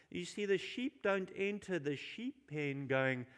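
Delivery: normal talking voice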